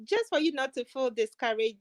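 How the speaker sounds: ordinary talk, nothing marked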